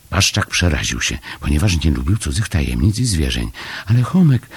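usual speaking voice

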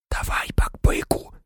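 hushed, whispered urging; barely audible. A narrator's reading. Harry secretly urging Buckbeak to move, barely audible. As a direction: coax it under your breath. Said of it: "ледь чутно проказав Гаррі".

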